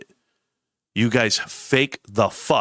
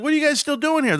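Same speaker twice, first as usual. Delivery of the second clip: surprised greeting. Retold or imitated. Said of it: "I was like".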